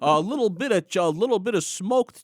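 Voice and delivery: put-on high, lively Yiddish accent.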